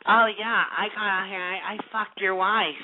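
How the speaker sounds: put-on fruity imitation of a caller; mocking effeminate voice. Host mimicking prank caller's boast, mock effeminate. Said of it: "fruity ass, femmy ass getting on here saying".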